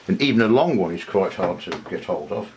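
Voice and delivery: usual speaking voice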